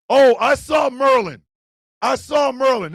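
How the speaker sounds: mock excited hire.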